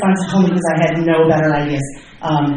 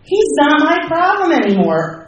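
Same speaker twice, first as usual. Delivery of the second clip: pitched way up, delighted at the thought; like a weight just lifted; relieved realization; 11 semitones higher, 4 dB louder than usual. Retold or imitated. Relief at being told.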